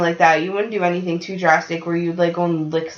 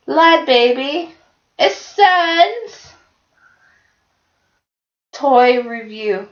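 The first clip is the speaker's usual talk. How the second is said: slow, higher sing-song announcer intro; mock channel intro; 5 semitones higher, 4 dB louder, much slower than usual. Joking to a friend about a YouTube channel, slow mock intro voice. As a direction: drawl it out like a kids' channel title. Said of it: "where it's like".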